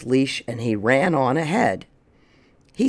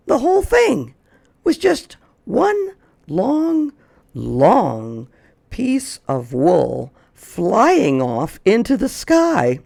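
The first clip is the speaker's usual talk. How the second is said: rising, drawn-out storyteller wonder; building excitement; 7 semitones higher, 4 dB louder, slower than usual. A narrator's reading. Narrator to children, building wonder slowly. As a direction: stretch out long, long for effect.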